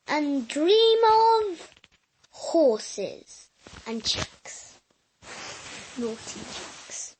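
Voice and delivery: sleepy bedtime wish. Real, not acted. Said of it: "Boys and girls".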